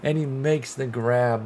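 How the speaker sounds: ordinary talk, nothing marked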